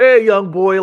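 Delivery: exaggerated German-accented coach shout; Klopp impression. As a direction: belt it out as an over-the-top mock of the manager. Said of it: "I can't do his accent".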